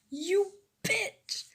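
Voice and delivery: high-pitched mock yell; angry yell. Jealous girl to her classmate, yelling. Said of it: "Uraraka yelled".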